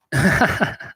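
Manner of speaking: high breathy burst of laughter; real laugh. Real, not acted. Streamer amused by younger people online, laughing. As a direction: crack up laughing.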